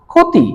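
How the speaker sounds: ক্ষতি said as "khoti", ক্ষ spoken as খ; demo pronunciation. Shown as a demonstration. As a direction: show that ক্ষতি starts with a plain kh sound. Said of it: "ক্ষয়ের মতন". (ক্ষতি শব্দটি "খতি" উচ্চারণে, স্পষ্ট করে)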